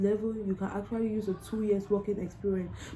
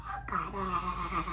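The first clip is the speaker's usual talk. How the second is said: crying through the memory of the visa; tearful; 9 semitones higher than usual. Real, not acted. Tearful retelling of the visa news, voice breaking. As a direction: voice breaking high with joyful tears. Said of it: "I was in tears".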